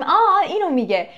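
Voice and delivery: oh, now I get it; sudden realization. Acted. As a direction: act out catching on, voice jumping up. Acting out sudden understanding.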